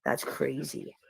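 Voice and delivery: hushed, low, stunned wow; murmuring quietly to herself while watching the border stream. Retold or imitated. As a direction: let it drop away in disbelief. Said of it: "I was just like".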